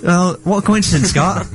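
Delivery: ordinary talk, nothing marked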